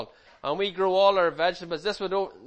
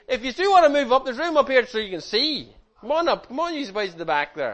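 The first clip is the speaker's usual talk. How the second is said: calling out; 6 semitones higher, 4 dB louder, faster than usual. Real, not acted. Inviting children to the front.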